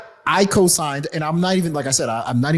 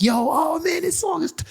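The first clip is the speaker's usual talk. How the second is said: gushing fake fans; 12 semitones higher than usual. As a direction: imitate bandwagon fans in a high voice. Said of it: "people wanna act like".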